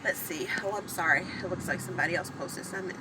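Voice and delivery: ordinary talk, nothing marked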